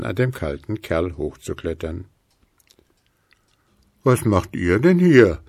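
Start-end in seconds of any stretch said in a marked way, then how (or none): 4.0-5.4 louder, different ghostly voice; ghosts asking. A narrator's reading. Ghosts float up to the snowman, calling louder. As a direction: call out like a curious ghost. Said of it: "fragten da Spuki und Gruseli".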